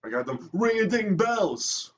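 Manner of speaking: slow, high singsong brag; playful boast. Acted. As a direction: savor it like a showoff. Playful boast about money.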